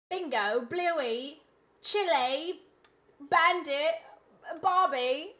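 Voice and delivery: exaggerated Aussie twang; cheesy fake Australian greetings and words; bad Australian accent. Shown as a demonstration. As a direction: show off a badly faked Australian accent. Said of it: "This Australian accent is offensively bad".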